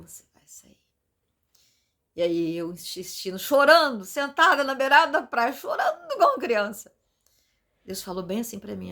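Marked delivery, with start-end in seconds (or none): 0.0-0.8 whisper. Retold or imitated. Voicing God's reply to her. 5.5-6.9 laughing. Real, not acted. Telling how she cried on beach.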